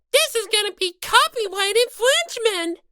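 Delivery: usual speaking voice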